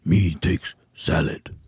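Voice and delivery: slow, dim caveman voice; caveman impression. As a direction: play a dumb caveman eating salad. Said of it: "Here's my impression of you".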